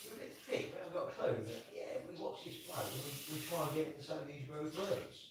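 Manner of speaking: hushed, breathy impression of the viewers; whispered mock confession.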